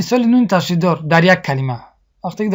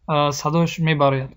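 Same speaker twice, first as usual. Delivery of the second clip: ordinary talk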